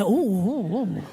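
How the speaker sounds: low leering oohs. Acted. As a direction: ogle her with low drawn-out oohs. Men leering at passing woman.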